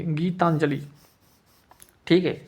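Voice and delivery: ordinary delivery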